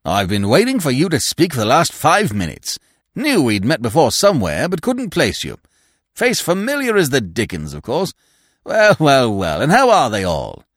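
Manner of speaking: put-on hearty, exuberant old-pal voice; loud, gushing greeting to a supposed friend; jovial stranger. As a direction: play the over-friendly chap, lively and loud.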